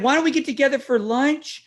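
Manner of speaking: high, eager mom impersonation; mock-chummy, lifted high; gushing mom. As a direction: imitate a mother pitching a friendly invitation. Imitating mom's lunch invitation.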